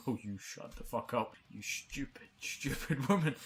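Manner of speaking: slow, contemptuous put-down; mock telling off. Retelling how he told off the pushy guest, mock contempt. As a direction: retell snapping at her, half-laughing. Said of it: "I just turned around on the spot and went".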